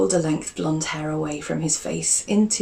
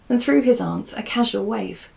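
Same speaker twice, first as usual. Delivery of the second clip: usual speaking voice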